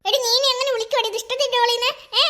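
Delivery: put-on a stuck-up doll brushing the girl off; haughty doll. Doll haughtily refusing to play with the girl.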